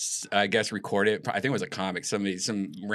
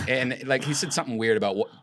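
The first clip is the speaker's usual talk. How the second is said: ordinary talk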